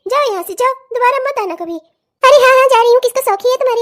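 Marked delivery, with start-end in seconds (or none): 2.2-3.8 loud offended going; loud huffy exit; huffy shout. Acted. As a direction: storm off shouting. Neighbour leaves shouting.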